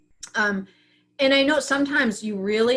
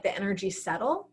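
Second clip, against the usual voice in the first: normal talking voice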